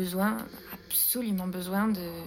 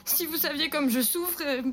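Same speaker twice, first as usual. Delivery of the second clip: higher, tearful pleading with broken words; a desperate cousin weeping as she begs; crying and stammering; 6 semitones higher than usual. A narrator's reading. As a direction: beg through sobs, stumbling on the words. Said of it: "Elle pleurait et bégayait".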